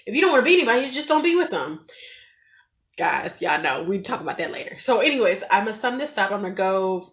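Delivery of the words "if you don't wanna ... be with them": high, louder matter-of-fact advice; naive reasoning. Retold or imitated. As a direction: state the simple answer, pitched up. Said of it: "I had a very naive look at things".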